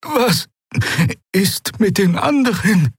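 slow, high, strained question; husky voice. A narrator's reading. Shaken woman asking after the others, husky and strained. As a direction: ask it slowly with a clogged throat. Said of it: "sagte sie mit belegter Stimme".

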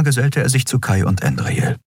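plain reading voice

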